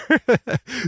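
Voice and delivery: a short real laugh off to the side; laughing in the background. Real, not acted. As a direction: someone else laughing at the nervous host. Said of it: "[name]'s laughing here".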